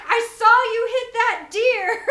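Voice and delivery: loud, high voice full of laughter; laughing quote. Retold or imitated. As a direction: shout it through laughter. Ranger's line retold while laughing.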